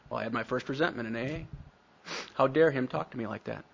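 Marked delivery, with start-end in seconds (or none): none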